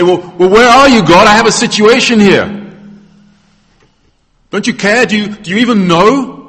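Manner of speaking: loud, high demand for answers; desperate appeal to God. Acted. Believer under threat confronts God, loud exasperated appeal. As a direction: cry out upward in acted exasperation. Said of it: "you say".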